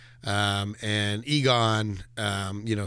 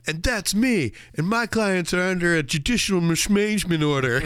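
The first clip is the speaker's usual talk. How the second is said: high-pitched, hammed-up courtroom plea; imitated flustered movie lawyer; 8 semitones higher, 4 dB louder than usual. Mimicking the film lawyer botching his legal terms, high and agitated. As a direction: blurt it high, like a bumbling lawyer. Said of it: "and he's like".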